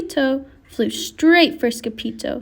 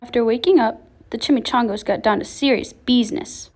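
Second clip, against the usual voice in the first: ordinary delivery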